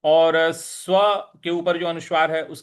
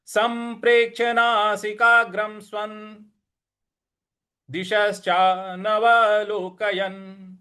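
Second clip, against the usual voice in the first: model recitation; 5 semitones higher than usual. Shown as a demonstration. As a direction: recite cleanly for students. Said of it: "तो पूरी पंक्ति हो जाएगी". (पूरी पंक्ति का पाठ)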